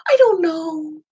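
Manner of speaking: high, whiny "I don't know"; insecure voice. Shown as a demonstration. Coach mimics victim language, high whiny unsure voice. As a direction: sound small and unsure. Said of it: "I'm using funny voices".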